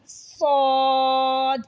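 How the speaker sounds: صاد hissed, thick and drawn out; slow heavy whistling صاد; heavy hissing suad. Shown as a demonstration. Teacher showing the thick hissing صاد. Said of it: "صاد کو ہم نے سیٹی بھی کرنی ہے اور موٹا".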